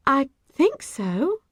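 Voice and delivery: tentative little voice; uncertain. A narrator's reading. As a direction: say it as if unsure. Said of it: "I said uncertainly".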